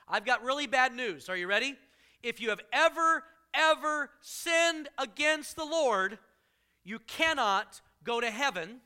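forceful pulpit delivery; preaching. Acted. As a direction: build it up like a preacher warning the flock. Preaching bad news.

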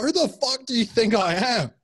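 high-pitched mock outrage; indignant retort. Retelling indignant reply to stranger.

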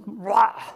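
short loud growled burst; mock angry roar. Irritated parent snapping at the kids, mock roar. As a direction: bark out an irritated rah.

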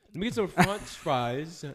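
slow, laughing "let me get some France fries"; mock fast-food order. Joking customer ordering at a counter, laughing slowly. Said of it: "it was a joke".

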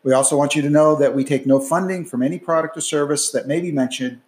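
brisk, clear announcer read of the disclaimer; podcast outro read. Acted. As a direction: read it like a formal podcast sign-off.

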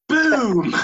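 high loud punchline shout; shouted boom. Real, not acted.

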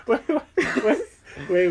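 laughing through the words. Real, not acted. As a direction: breaks into high laughter.

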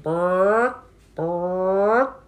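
high squawky chicken "Bark". Acted. Chicken squawking "Bark" at the librarian.

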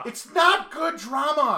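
high, slow, drawn-out shout; hammed-up dramatic lament; anguished mock protest. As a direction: wail every word in mock despair.

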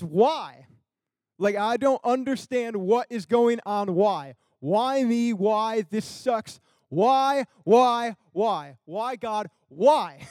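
loud, anguished why, each one pushed harder; exasperated crying out. Retold or imitated. Stuck at a roach motel, asking God why, loud and exasperated. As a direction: cry out why to the sky, fed up and loud.

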